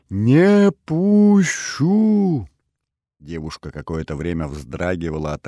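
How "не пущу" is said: очень высокий, громкий, растянутый вопль; вцепиться и завопить в слезах, растягивая слова; рыдающий вопль. A narrator's reading. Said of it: "прорыдала Олеся и вцепилась в меня мёртвой хваткой". (истошно, сквозь рыдания)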